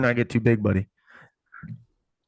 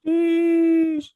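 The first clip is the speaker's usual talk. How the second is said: excited name call; 6 dB louder than usual. Acted. Repeating the kid's name.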